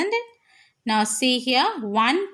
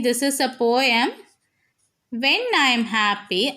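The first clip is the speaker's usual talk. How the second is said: normal talking voice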